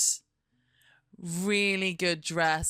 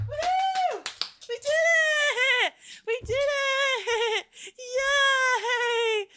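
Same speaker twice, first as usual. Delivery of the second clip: very high, loud laughing cheer; squealing happy shout; victory cheer; 5 dB louder, much slower than usual. Real, not acted. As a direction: celebrate the kill with the dog. Celebrating killing the fly.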